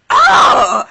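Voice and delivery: mock outcry. Retold reaction, shouted.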